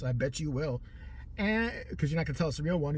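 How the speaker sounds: normal talking voice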